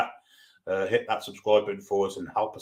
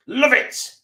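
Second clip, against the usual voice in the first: high, loud, gleeful squeal of love it; thrilled streamer squealing back at a chat message, high and loud; excited; 13 semitones higher, 8 dB louder than usual. Acted. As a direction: shoot the voice way up and belt it out with delight. Streamer repeats phrase, building up.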